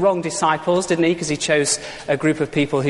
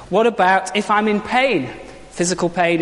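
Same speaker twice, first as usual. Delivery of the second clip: ordinary talk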